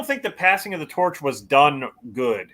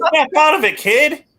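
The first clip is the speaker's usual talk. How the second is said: mock scolding; 9 semitones higher, 4 dB louder than usual. Acts out slapping Tyler out of it.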